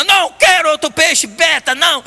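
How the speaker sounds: put-on the stubborn girl saying no; child refusing. Granddaughter refuses higher.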